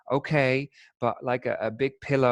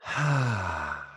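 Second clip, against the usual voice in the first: slow audible exhale; unhurried sigh to settle in; long settling sigh; 4 semitones lower than usual. Real, not acted. Settling in before the meditation begins.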